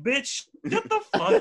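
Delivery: high-pitched laughing protest; mock indignant outburst. Patient after swab reached his brain, high mock outrage.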